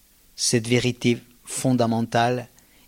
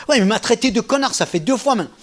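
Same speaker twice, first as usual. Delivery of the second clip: higher, louder indignant protest; angry student; 8 semitones higher, 4 dB louder than usual. Retold or imitated. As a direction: insist on the grievance. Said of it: "angry student".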